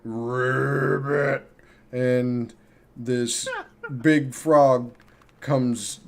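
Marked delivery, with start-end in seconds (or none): 0.0-1.5 deep ribbit. Acted. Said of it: "this deep ribbit".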